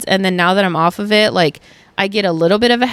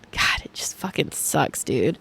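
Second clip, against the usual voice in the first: whispered low and much softer; 7 semitones lower, 11 dB quieter than usual. Real, not acted. To listeners, whispering in exhausted frustration. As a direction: breathe it out as a defeated whisper. Venting quietly about period pain.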